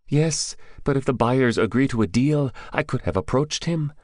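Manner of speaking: high-pitched captive's voice; high, keen underling voice; eager captive. Acted. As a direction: pitch it well up, eager to explain. Captive's figure in narrated story.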